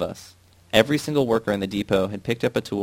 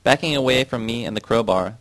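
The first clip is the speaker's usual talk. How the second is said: plain reading voice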